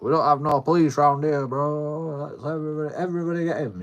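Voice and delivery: mock a man calling the street against someone; hostile threat. Acted threat from a hostile local.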